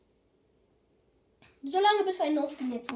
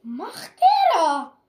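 laughing in disbelief; 3 semitones higher, 11 dB louder, slower than usual. Real, not acted. Laughing at another player.

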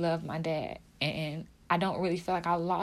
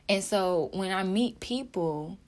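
usual speaking voice

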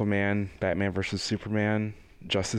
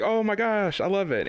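put-on excited kid; 11 semitones higher than usual. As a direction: gush like a thrilled child. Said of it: "he's like".

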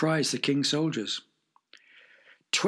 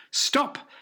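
high sharp shout; 11 semitones higher than usual. Acted. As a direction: shout to halt the sword. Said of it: "shouted Horatio's servant".

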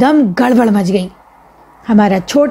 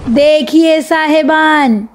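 slow, higher showman's spiel; a boy playing a hawker-style magician, slow and higher; street magician patter; 4 semitones higher, slower than usual. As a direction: drum up the crowd like a roadside conjuror. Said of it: "بچوں سے بولے".

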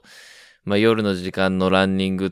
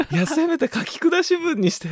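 pleading. Retold or imitated. Inner plea, much higher.

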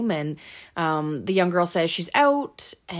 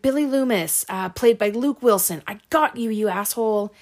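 raised, louder mock-triumphant answer; imitated triumph; 4 semitones higher, 6 dB louder than usual. Girl beating the killer at his quiz, mock triumph. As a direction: act out a girl throwing the answer back.

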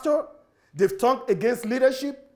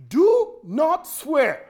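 higher, louder, very slow Do not swear; slow emphatic command; 6 semitones higher, 4 dB louder, much slower than usual. Acted. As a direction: hammer each word out. Emphatic command after quotes.

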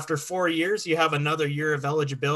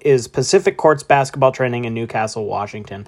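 loud, upbeat sponsor read; 9 dB louder than usual. Acted. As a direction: sell it like a radio spot. Reads sponsor ad.

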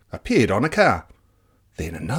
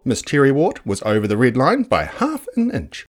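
ordinary delivery, nothing marked

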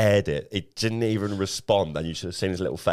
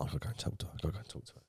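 whispering to himself, pumping himself up; breathy self-talk under his breath; whispered inner voice; 4 semitones higher, 11 dB quieter, much faster than usual. Retold or imitated. Lad in the pub whispering to himself, eyeing a girl. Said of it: "And I was like".

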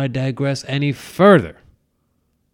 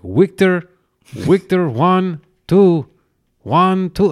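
higher, louder mock Russian accent with W for V; punchy Russian accent, Victor begun with a W; Chekov; 4 semitones higher, 4 dB louder than usual. Host imitating a TV Russian, Russian accent with W for V. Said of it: "he'd always be like".

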